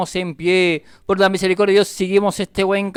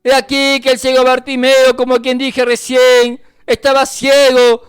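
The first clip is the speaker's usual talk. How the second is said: impassioned preaching; 6 semitones higher, 5 dB louder than usual. Real, not acted. Preacher urging listeners to get up and trust, impassioned. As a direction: rouse the congregation with insistent force. Exhorts congregation at full force.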